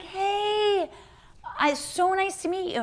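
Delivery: high, sugary polite hello; overly sweet greeting. Retold or imitated. Greeting his girlfriend's mom, high and sugary. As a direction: gush politely, naive and keen.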